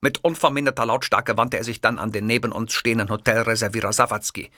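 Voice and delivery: usual speaking voice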